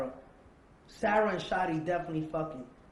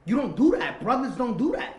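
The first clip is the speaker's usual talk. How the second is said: loud, higher-pitched indignant protest; 8 semitones higher, 10 dB louder than usual. Retold or imitated. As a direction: push it out loud, as a reproach. Indignant retold reproach of the friend.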